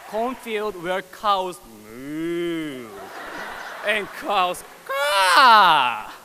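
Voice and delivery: slow, stretched cow bellow; mooing cow voice. Acted. Poet mocking his rural cornfield, slow cow-like bellow. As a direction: drag the words out like a cow.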